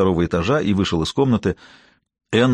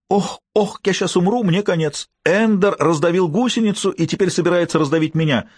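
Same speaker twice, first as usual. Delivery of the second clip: high hammed-up terror; mock fear; 8 semitones higher than usual. A narrator's reading. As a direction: fake panic, mocking. Peter mocking fear.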